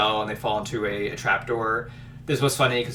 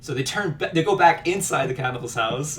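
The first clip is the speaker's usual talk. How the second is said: normal talking voice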